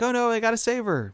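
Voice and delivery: high-pitched mock alarm; panicked. Dad about to jump after daughter, panicked. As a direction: blurt it out in a hammed-up panic.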